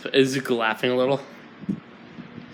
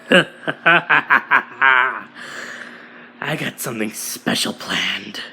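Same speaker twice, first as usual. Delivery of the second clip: lower, menacing villain boss; deeper villain voice; 3 semitones lower than usual. Acted. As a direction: drop the voice low and sinister. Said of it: "making his voice a little deeper".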